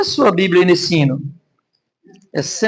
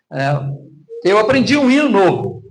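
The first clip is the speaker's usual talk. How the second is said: normal talking voice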